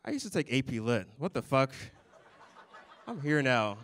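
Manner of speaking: ordinary talk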